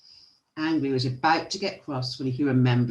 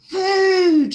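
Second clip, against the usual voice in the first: high, loud, drawn-out holler; long high yell at the sight of dinner; excited shout; 11 dB louder than usual. A narrator's reading. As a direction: bellow it greedily like hungry dwarfs. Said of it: "shouted the dwarfs".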